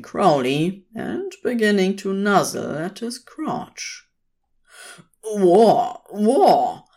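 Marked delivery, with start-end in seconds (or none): 5.2-6.8 loud, alarmed exclamation; startled protest. A narrator's reading. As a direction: call out to halt an unwanted advance. Startled by sheep nuzzling his crotch.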